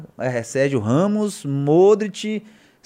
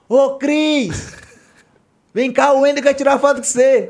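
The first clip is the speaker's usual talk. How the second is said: high, loud call to a teammate; 11 semitones higher, 6 dB louder, slower than usual. Retold or imitated. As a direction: shout across the box to Cris. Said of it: "faz assim". (gritando alto para o Cris)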